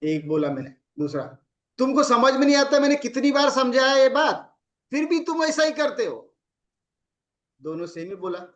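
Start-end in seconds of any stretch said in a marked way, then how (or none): 1.8-6.2 higher and louder, exasperated telling-off; angry scolding. Shown as a demonstration. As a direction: snap at him, fed up. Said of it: "इसको मैंने ऐसे बोला और एक इसको ऐसा बोला".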